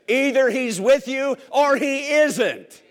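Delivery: slow, high and loud, daring them to doubt; emphatic challenge. Real, not acted. Preacher challenging the congregation, slow and loud. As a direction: lay it down slowly and forcefully.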